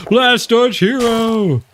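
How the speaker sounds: high, loud, drawn-out cheer; excited cheer. Real, not acted. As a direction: stretch the name out in a high triumphant call. Cheering the named player.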